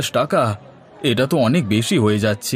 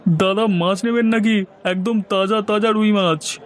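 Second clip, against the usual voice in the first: higher put-on voice calling out to a customer; fish seller; 8 semitones higher than usual. Fish seller touting his rui to a passing man, higher put-on voice. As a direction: hawk fresh fish in a raised voice.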